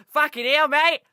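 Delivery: shouted, high-pitched mock Australian accent. Podcaster imitates Aussies in a game world, loud Australian accent. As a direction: yell it as an over-the-top Aussie. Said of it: "people with Australian accents going".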